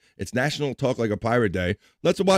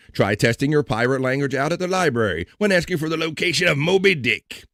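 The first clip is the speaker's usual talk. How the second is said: ordinary talk, nothing marked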